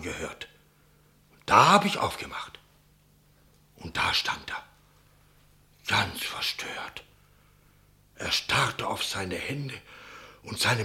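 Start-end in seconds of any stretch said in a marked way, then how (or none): 3.8-7.0 whispered, slow and breathless; hushed whisper. Acted. Innkeeper to the captain, recalling the eerie find in a whisper. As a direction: drop to a whisper at the horror.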